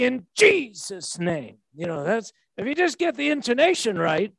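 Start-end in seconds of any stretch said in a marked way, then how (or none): 0.0-1.6 "Jesus'" drawn out with heavy mock emphasis; slow, over-meant "In Jesus' name", leaning hard on "Jesus'"; said with meaning. Preacher mocks coached prayer wording, said with exaggerated meaning. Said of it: "say it with meaning".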